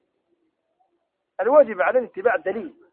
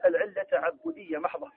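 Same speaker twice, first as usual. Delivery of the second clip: ordinary talk, nothing marked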